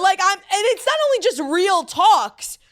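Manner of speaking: high, loud self-justifying rush; defensive. Real, not acted. Host insists she is as real as it gets, defensive. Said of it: "Why are you being defensive?".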